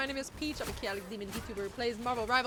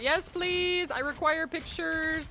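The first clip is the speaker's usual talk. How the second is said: eager excited; 7 semitones higher, 7 dB louder, slower than usual. Real, not acted. Eager reply to chatter.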